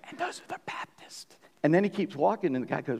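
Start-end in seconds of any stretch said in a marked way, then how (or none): 0.1-1.4 breathy secret whisper, very soft. Acted. Heavenly guide pointing out the Baptists, in a secretive whisper. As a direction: let it slip like a secret behind your hand. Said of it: "why did you whisper?".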